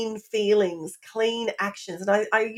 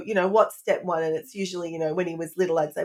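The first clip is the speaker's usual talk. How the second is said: ordinary delivery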